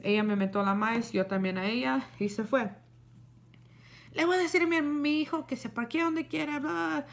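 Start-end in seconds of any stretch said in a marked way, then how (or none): 4.1-7.1 higher, dismissive parroting; mocking neighbor. Retelling the neighbor's parting threat about her son, higher and mocking. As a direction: mimic her threat as nonsense.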